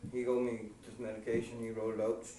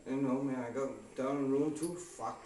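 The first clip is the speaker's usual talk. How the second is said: usual speaking voice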